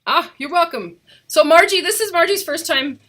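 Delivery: normal talking voice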